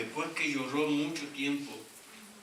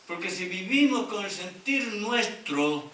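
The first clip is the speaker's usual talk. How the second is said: higher, louder, slower sermon cry; fervent preaching; 8 semitones higher, 6 dB louder, slower than usual. Real, not acted. Pastor urging the congregation to share Christ's mind, fervent and loud. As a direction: press the call home to the flock.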